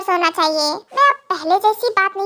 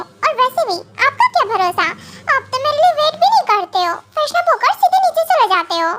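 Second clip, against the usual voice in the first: higher, chiding wife, a little annoyed; complaining young wife; 5 semitones higher than usual. Acted. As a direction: scold him lightly for never waiting. Wife complains about husband. (ऊँची, हल्की शिकायत करती पत्नी)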